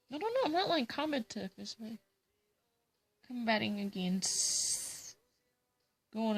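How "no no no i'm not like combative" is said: protesting no. Real, not acted. Denying being truculent.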